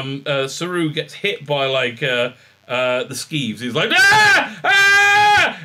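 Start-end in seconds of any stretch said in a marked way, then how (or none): 3.9-5.6 very high, loud yelp; hammed-up shrieks of panic; mock panicked scream. Imitating Saru hit by the skeeves, screaming. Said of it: "he starts to freak out".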